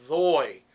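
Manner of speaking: "thoy" with a voiced th and an oi vowel. Shown as a demonstration. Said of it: "using a T H and an O I sound".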